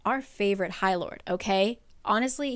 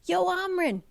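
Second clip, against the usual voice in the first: higher, breezy voice; high casual call; 9 semitones higher than usual. Retold or imitated. Rhys inviting Amren back, high and casual. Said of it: "he was kinda like".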